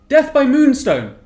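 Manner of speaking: dramatic shout. Acted. Shouted message over mind link.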